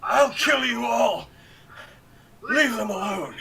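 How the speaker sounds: loud, high furious shouting; berserk rage. Acted. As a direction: scream it at the gods. Delirious survivor raging at gods.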